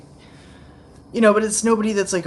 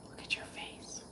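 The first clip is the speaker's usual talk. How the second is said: very soft, voiceless whisper; 22 dB quieter than usual. Real, not acted. Owner admiring Chloe's face, whispering. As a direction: whisper it privately to a pet.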